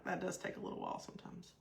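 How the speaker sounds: quick whispered aside to herself; whispered inner thought. Retold or imitated. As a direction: murmur it fast under your breath, like a private thought. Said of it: "I was like".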